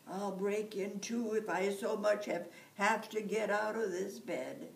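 whining. Acted. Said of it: "said Jamie would whine".

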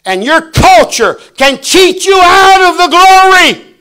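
loud, high, drawn-out pulpit shout; preaching shout. Real, not acted. Preacher warning the church culture can cheat them, shouting. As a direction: cry out a slow, forceful warning.

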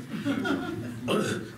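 short breathy chuckle; a quick amused laugh; light laugh. Real, not acted. Crowd answers quiz, speaker amused.

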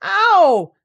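loud, high "Ow!"; high pained yell; crying out. A narrator's reading. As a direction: yelp while being scrubbed. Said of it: "cried Piglet".

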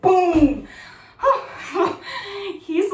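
normal talking voice

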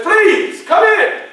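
high, loud, welcoming invitation; Lincoln's welcome. Acted. Lincoln loudly welcoming Douglass into the office. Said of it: "He says".

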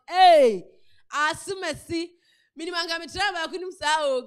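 woman crying out about her second marriage, loud; retold outcry. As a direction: burst out, then keep it heated. Woman's retold outburst between marriages.

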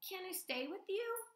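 begging to stay; young gosling. Acted. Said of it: "Bright Bill pleading".

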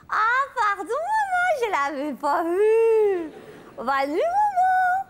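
put-on high sweet little girl; child voice. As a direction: chirp an innocent sorry. Child apologises, says goodnight. (voix de petite fille innocente)

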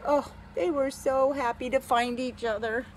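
ordinary delivery

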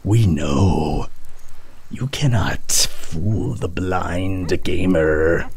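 slow, low mystical intoning; lightly voiced slow prophetic pronouncement; mock oracle. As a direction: intone it like a blind seer.

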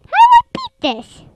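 high panicked shriek of frustration; scared horror game streamer. Real, not acted. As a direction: yelp it, rattled and shaken.

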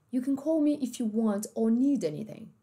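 British host family, or said as "aw"; British accent. Shown as a demonstration. Host family talking to au pair, British accent. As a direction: say it British, dropping the R. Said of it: "uma família mais britânica".

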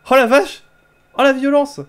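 loud high amazed exclamation; excited shout. Real, not acted. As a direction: yell it, thrilled by the violence. Brutal hammer hit in game.

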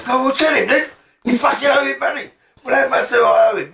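high, exasperated quarrelling; heated retold argument. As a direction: act out a row over money. Acted three-way quarrel about money owed.

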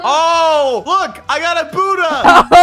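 high, loud, drawn-out boast; excited crowing. Real, not acted. Player flaunts Buddha to rival, excited crowing. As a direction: show off the find at full volume. Crows over a lucky block.

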